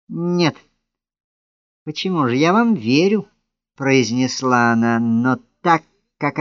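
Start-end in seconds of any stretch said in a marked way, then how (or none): 0.1-3.3 high, haughty mock agreement; contemptuous, snide. A narrator's reading. Woman pretends to believe her admirer, with haughty contempt. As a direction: feign belief with lofty scorn, pitch raised. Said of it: "с таким презрением и ехидством".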